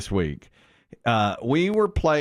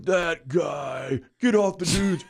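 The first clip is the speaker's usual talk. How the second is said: son mocking neighbor; 5 semitones higher, slower than usual. Son in the car mocking the neighbor, higher mock yell. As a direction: point him out and ham the line. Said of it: "He's like".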